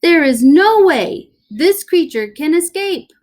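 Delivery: showman doctor. Acted. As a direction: proclaim it to the crowd. Doctor addresses crowd.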